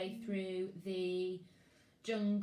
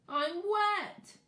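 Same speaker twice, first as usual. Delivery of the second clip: put-on high, louder little boy's wail; childish wail; 10 semitones higher, 6 dB louder than usual. Max fallen in the lake, high childish wail. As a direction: whine like a soaked toddler. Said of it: "he wailed".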